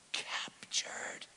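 breathy hushed whisper on captured; dramatic whisper. Acted. Preacher whispering the ark's capture to the congregation. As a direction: drop to a whisper for the bad news.

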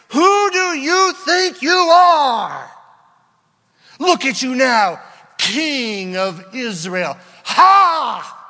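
high, loud sneering shout; mock him without mercy; taunting mocking voice. Voice taunting David in cave, sneering shout.

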